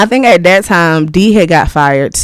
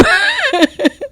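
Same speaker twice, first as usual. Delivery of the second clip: high laugh; 4 dB quieter than usual. Real, not acted. Laughs about stashed money.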